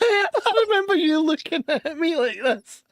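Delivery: high, slow, laughing through the words; can barely get it out for laughing. Real, not acted. Host recalling his friend's face, laughing hard.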